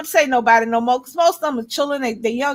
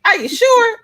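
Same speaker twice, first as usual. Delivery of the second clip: higher, pushier are you sure; mock insistent; 8 semitones higher, 3 dB louder, slower than usual. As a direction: hammer on sure with a higher pitch. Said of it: "He was like".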